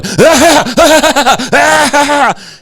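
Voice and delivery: very high, shrieking mock cackle; put-on high-pitched laughing fit; imitated hysterical laughter. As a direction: mimic him laughing his ass off. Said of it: "laughing his ass off".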